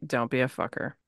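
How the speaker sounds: normal talking voice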